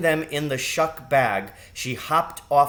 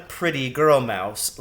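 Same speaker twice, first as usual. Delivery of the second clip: plain reading voice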